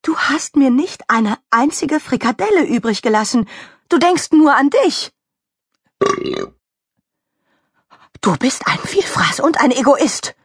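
high, accusing telling-off; scolding. Acted. Mickey Kuchen telling off the selfish glutton, scolding. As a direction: accuse them indignantly. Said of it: "schimpfte Mickey Kuchen".

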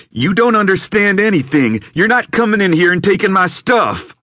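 very high, loud yelling; furious shout. Acted. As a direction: explode at the intruder. Gunman explodes at Mason.

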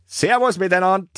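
high, jaunty parting shout; called-out goodbye. A narrator's reading. Toni to the horses behind, called goodbye. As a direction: send it after them. Pony sends goodbye after others.

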